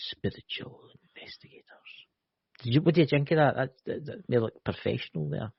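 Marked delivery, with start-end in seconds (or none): 0.1-1.6 breathy ASMR-style whisper. Pretend. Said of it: "you trying to go into A S M R videos now".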